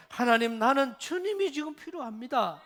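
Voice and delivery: a loud, earnest prayer; fervent prayer. Pretend. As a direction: call out to God with fervour.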